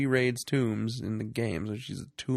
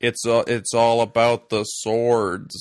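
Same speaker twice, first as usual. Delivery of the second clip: slow, much louder hammed-up American drawl; mock bad American accent; 10 dB louder, slower than usual. Mocking Daniel Craig's movie lines as a bad Harrison Ford. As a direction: ham up a gruff Harrison Ford parody. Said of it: "Harrison Ford making fun of Harrison Ford".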